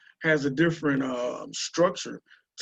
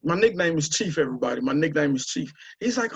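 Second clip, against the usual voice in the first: ordinary talk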